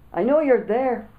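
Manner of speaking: loud, high call through a door. A narrator's reading. Boy calling through a letterbox, loud. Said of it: "I called out through the letterbox".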